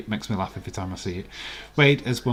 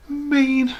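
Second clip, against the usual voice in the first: excited; 8 dB louder than usual. Real, not acted. Confirms the right answer.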